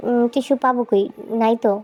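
ordinary talk, nothing marked

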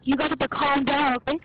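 agitated. Real, not acted. Arguing with teammates loudly.

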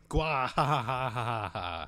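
long, slow evil guffaw; villain laugh. Acted. Player voicing Bowser owning up to Peach, mock evil laugh. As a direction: draw out a hammed-up monster laugh.

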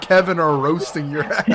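ordinary talk

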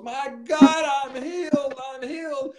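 high, loud cry of amazement; retold screaming. Man with back pain suddenly healed, imitated high shouting. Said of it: "all of a sudden he started screaming".

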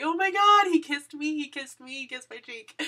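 high, loud squealing oh my God, then settling; excited squeal. Retold or imitated. Fan telling everyone in the hall, squealing with excitement. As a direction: burst out like a giddy teenager.

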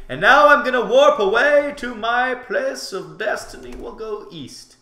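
high, loud mock-epic proclamation; grand proclamation. Player announcing a warp to his destiny, grandly. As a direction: declare your journey to destiny at full voice.

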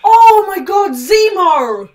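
very loud cry of astonishment; stunned shout. Real, not acted. Fan reacting to Zemo's act, stunned loud shout. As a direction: shout out, starting high and dropping. Shocked reaction to Zemo on screen.

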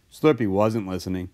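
usual speaking voice

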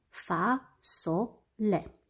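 "Fasole" very slowly in three pieces, fa - so - le; despărțit în silabe. Shown as a demonstration. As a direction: break "Fasole" into syllables with long gaps. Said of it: "are trei silabe". („Fasole” rostit foarte rar, pe silabe: fa - so - le)

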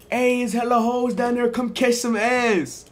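high voiced sister, slow at the end; sister's invite. Sister invites him down to the party, high mock voice. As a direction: play the sister calling you down. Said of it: "your sister come in your room and say".

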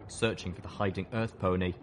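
ordinary delivery, nothing marked

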